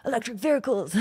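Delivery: hammed-up American pronunciation of "vehicles"; a brash, lightly done American accent. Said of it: "that was a terrible accent".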